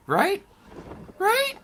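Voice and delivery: high-pitched mock doubt; climbing squeak. Nervous repeated question climbing.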